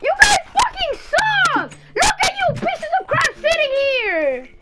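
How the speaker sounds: high, loud and slow raging shout; drawn-out screaming rant at lazy teammates; furious shout. Real, not acted. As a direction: yell at the whole team in fury.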